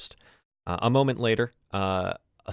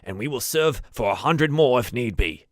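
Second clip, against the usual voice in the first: loud, raised, stately declaration; proud butler; 6 semitones higher, 4 dB louder than usual. Acted. Butler vouches for a century of service, proudly. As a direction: proclaim your loyalty with pride.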